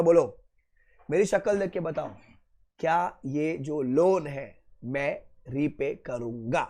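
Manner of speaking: normal talking voice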